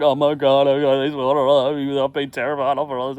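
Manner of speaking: loud, hammed-up wailing; mock weeping. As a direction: wail it as if crying, imitating despair. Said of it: "I'm sort of weeping".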